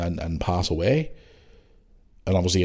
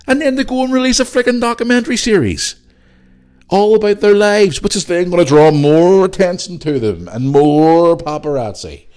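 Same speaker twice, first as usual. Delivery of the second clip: indignant rant; 10 semitones higher, 8 dB louder than usual. Real, not acted. Ranting about documentary hypocrisy.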